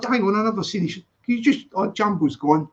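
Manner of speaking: ordinary talk, nothing marked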